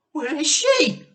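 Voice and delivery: high, loud frantic question; wife crying for lost mother; panicked cry. Acted. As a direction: cry out searching for her. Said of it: "cried Missus Cranky".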